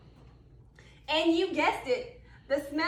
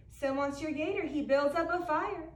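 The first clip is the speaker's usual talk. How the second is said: ordinary delivery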